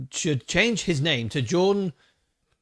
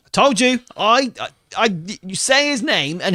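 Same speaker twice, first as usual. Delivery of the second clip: excited; 6 semitones higher than usual. Real, not acted. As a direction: gloat that you were right. Host's guest appears on cue.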